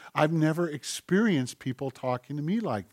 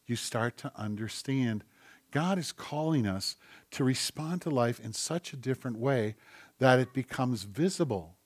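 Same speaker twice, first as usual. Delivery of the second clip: ordinary talk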